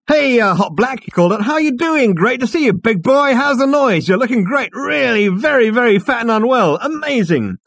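loud, high, tipsy bonhomie; drunk loud greeting. A narrator's reading. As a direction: call out drunkenly across the room. Drunk Ford calling out loudly.

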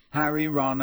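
ordinary delivery, nothing marked

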